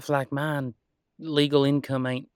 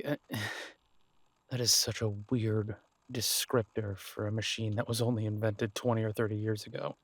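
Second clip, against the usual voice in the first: ordinary talk, nothing marked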